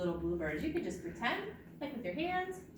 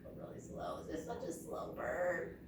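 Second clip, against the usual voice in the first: normal talking voice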